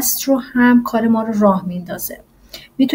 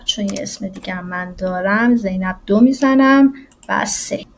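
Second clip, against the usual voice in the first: normal talking voice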